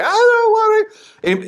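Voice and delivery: put-on high, pleading child's whine; whiny refusing kid. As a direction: whine like a kid who won't go.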